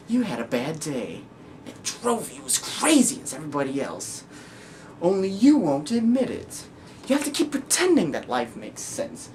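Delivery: theatrical villain monologue, swinging pitch; taunting madman, drawing out key words; Joker. Acted. Joker goading the one who caught him, theatrical and mocking.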